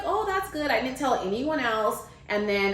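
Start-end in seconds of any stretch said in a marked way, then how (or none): 0.0-0.7 high-pitched retold 'oh' of approval; friend's approval. Friend hears about the book, high-pitched approval. Friend reacting happily to news.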